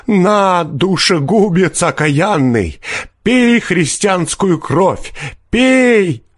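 high, drawn-out furious wail; fierce cursing. A narrator's reading. Peasant cursing forester as a bloodsucker, fierce. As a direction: curse him slowly, pitched high. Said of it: "подхватил мужик свирепым голосом".

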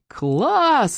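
put-on high, drawn-out kids' cheer; excited cheer. A narrator's reading. Boys respond to dad's TV offer, cheering. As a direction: exclaim with delight, pitched high. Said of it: "закричали мы дружно".

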